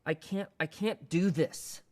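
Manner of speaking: distressed, insistent begging to stop; acted pleading. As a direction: plead that it is too much. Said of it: "swears or moans or weeps or pleads".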